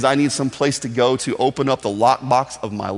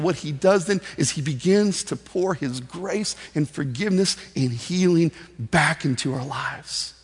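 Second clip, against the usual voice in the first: ordinary talk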